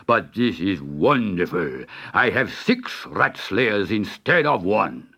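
put-on slow, grand, low accented voice; delighted Sultan. A narrator's reading. Sultan rewarding Dick for the cats, delighted. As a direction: rejoice expansively as a generous monarch. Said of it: "clapped his hands with delight".

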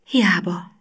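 voiceless whispered Hiába, falling. A narrator's reading. Gina giving up inside, whispering Hiába. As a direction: breathe Hiába out with no voice.

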